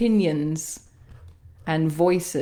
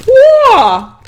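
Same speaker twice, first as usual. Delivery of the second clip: ecstatic shriek; 17 dB louder than usual. Real, not acted. Energy release, hot potato.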